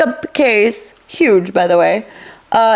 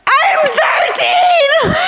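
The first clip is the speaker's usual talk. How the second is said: shout; much faster than usual. Retold or imitated. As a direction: yell it in outraged protest. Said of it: "I was like".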